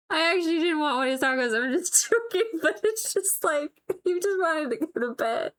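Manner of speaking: high-pitched, talking through real laughter; trying to explain while cracking up; laughing. Real, not acted. Wife explaining her joke about husband's tacos, laughing. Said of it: "I just kept laughing about it".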